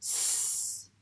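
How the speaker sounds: "S" as a long sustained sss; isolated S sound. Shown as a demonstration. Teacher showing how C sounds, "S" as long sss. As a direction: hold "S" as a pure hiss, not the letter name. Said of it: "se prononce S".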